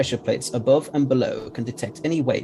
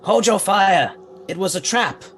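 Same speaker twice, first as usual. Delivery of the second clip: urgent shout. Acted. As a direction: shout across the room to stop them firing. Danger, ally electrocuted by trap.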